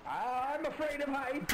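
high, drawn-out slow whine; frightened wail. Acted. Climber confesses a fear of heights, frightened wail.

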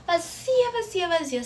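"Vazia" leaps up high, then "vazia, vazia" falls away; emphatic retold "vazia" repeated, the first very high; insistent repetition. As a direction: hammer "vazia" each time, the first one far above her voice. Hammering the word in the quarrel.

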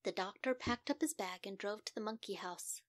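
plain reading voice

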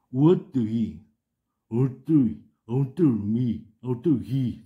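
teacher loudly modelling 얼뜨이 for the students; pronunciation demo. Shown as a demonstration. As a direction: sound out 우어뜨이 then 얼뜨이 with the L dropped. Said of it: "지금 이렇게 발음을 하는 겁니다".

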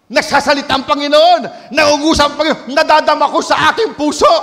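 preacher's steady exhortation; sermon delivery; preaching. Acted. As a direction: urge the congregation on.

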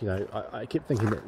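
ordinary talk

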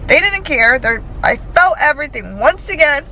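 venting hard after a painful procedure; pissed. Real, not acted. Ranting to camera after the painful procedure, angry. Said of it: "I'm yelling, I'm a little loopy".

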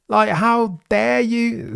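high, slow indignant how dare you; hammed-up outrage; mock outrage. Real, not acted. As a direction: drag it out in theatrical indignation. Indignant outburst at FIA.

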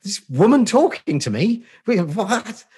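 high, loud, hammed-up disbelief; comic high-pitched incredulity; mock outrage. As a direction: act utterly flabbergasted by a stranger. Acting out his bafflement at friendliness.

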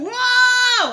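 loud, high shout of shock; startled whoa. Acted. Stepping back from the neighbor's remark, shouting in shock. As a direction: jump back with a big whoa.

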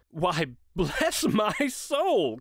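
slow, drawn-out amazement; astonished cry. A narrator's reading. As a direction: stretch each word in happy astonishment. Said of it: "cried Fred".

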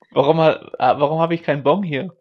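ordinary delivery

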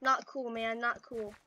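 usual speaking voice

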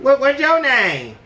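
put-on high, loud, brash girl calling out; hollering at a guy across the mall; mock loud girl voice; loud belligerent girl. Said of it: "extremely loud, belligerent, using a lot of cuss words".

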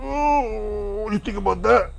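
teasing high-pitched voice; high put-on voice. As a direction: ask it again in a silly high voice. Same question redone in a put-on voice.

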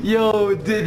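usual speaking voice

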